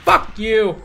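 full-throated angry shout; real rage yell. Real, not acted. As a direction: explode at the enemy. Game rage, repeated curse.

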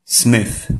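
"Smith" with a TH close to F; correct Smith. Shown as a demonstration. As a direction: end "Smith" with the tongue tip between the teeth. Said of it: "Prawidłowa wymowa tego słowa powinna brzmieć". (poprawna wymowa: "Smith" z TH podobnym do F)

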